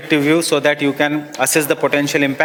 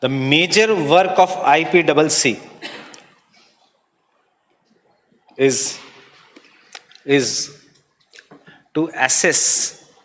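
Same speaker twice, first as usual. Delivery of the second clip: slow, measured note dictation; classroom dictation; much slower than usual. Real, not acted. Teacher dictates notes on IPCC to students, slow dictation. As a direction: dictate in short chunks, leaving long pauses.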